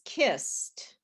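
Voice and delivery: "kissed" said slowly, ending in T; final T sound. Shown as a demonstration. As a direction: end "kissed" on a T, one syllable. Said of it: "which of the words sound like T".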